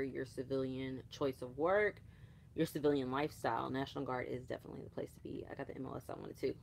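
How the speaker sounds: ordinary talk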